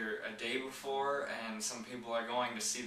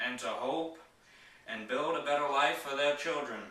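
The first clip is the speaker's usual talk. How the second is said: raised, ringing presidential oratory in measured phrases; higher, louder, declamatory podium delivery; JFK impersonation; 3 semitones higher, 5 dB louder than usual. Acted. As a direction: give a famous speech in an imitated statesman's voice, loud and emphatic, pausing between phrases. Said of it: "going to do my J F K impersonation".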